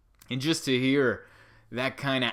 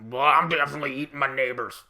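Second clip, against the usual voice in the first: louder, gruff mock pundit growl; Alex Jones; 3 semitones higher, 6 dB louder, faster than usual. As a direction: lightly growl it like a jowly radio host. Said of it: "Alex Jones has the voice and the jowls".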